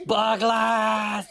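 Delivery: high, loud shout of his name; chasing war cry. Acted. Bug hero chasing fleeing raiders, bellowing. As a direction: bellow as you chase them.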